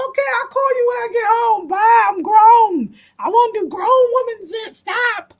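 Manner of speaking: high, fast, breathless goodbye; hurried brush-off. Retold or imitated. To Kwame while dashing to the cab, high hurried brush-off. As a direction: wave him off while escaping.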